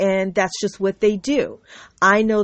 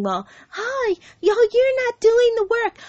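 high-pitched mock voice, far above usual; mocking imitation; 14 semitones higher than usual. Mimicking detractors sitting around complaining about the work, mocking. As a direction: put on a high, silly voice to mock. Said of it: "sitting around going".

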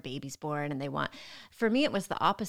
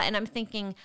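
ordinary delivery

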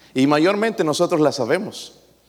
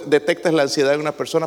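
ordinary delivery

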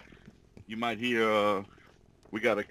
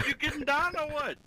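high excited quote; 14 semitones higher than usual. Retold or imitated. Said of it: "I said".

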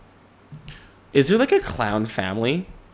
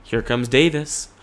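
ordinary talk

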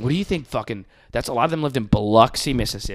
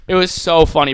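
ordinary delivery

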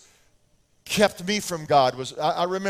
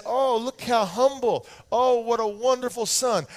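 mock praise; 6 semitones higher than usual. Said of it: "I wouldn't say".

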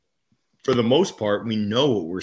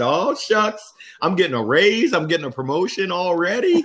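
high, bright, eager self-quote; giddy excitement; 9 semitones higher, 3 dB louder than usual. Retold or imitated. New salesman thrilled he's being promoted, giddy. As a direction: squeal with joy at a raise. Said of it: "I thought I was getting a promotion".

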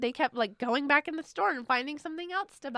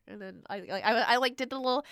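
ordinary talk, nothing marked

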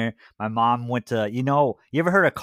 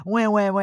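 high whiny wah-wah; mock crying; 9 semitones higher than usual. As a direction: fake baby crying. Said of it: "I don't hear you crying".

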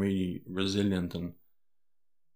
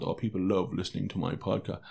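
softer, offhand, as if it were nothing; nonchalant; 4 dB quieter than usual. Shown as a demonstration. Podcaster mock-quoting his own boast to listeners, nonchalant and softer. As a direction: toss it off casually like a smug boast. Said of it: "sounds so nonchalant".

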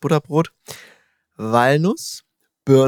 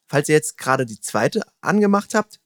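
normal talking voice

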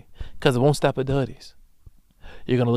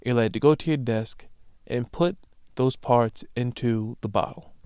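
usual speaking voice

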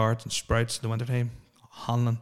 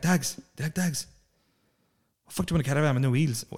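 higher-pitched Irish traveller accent, mocked; 3 semitones higher than usual.

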